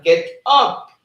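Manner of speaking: host voicing a chat order to Byron, commanding shout.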